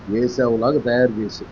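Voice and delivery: ordinary delivery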